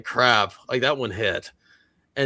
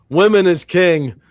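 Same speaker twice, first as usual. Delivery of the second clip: higher, louder jokey declaration; mock proclamation; 7 semitones higher, 9 dB louder than usual. Joking to chat that women rule, mock proclamation. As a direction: proclaim it like a hammed-up slogan. Mock rant about men.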